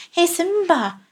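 high, eager call; urgent little high-voiced hail; quick call. A narrator's reading. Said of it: "rief Chilp rasch".

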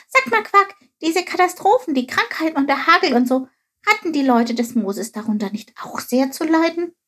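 high-pitched little figure; inquisitive Chilp. A narrator's reading. Chilp asks Quark if Moses' people suffered, high questioning voice. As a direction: ask away in a high, concerned voice.

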